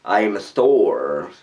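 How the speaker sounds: low, mock-heroic superhero voice; hammed-up deep god voice, drawn out; Thor. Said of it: "a little bit more".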